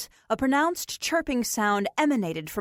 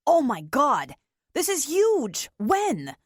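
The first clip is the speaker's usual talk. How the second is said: excited; 5 semitones higher, slower than usual. Acted. Harrison hears baby news.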